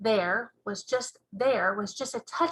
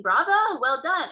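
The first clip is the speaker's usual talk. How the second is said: mock cheering shout; 5 semitones higher, 6 dB louder than usual. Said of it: "cheering like mad and shouting".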